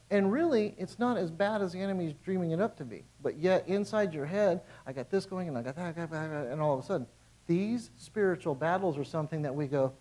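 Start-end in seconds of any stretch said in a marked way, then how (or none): none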